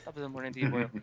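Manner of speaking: lightly voiced Irish greeting; a hint of Irish brogue; Irish accent. Retrying the gunsmith's greeting, light Irish accent. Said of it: "Fucking Irish".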